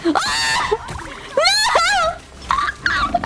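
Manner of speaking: high shrieking cry; real startled scream; panicked scream. Real, not acted. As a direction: scream as he gets caught. Caught in game fight.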